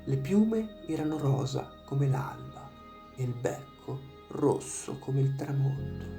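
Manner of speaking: usual speaking voice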